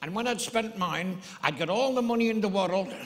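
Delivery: high, loud Irish punchline; Irish accent. Retold or imitated. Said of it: "this little Irishman".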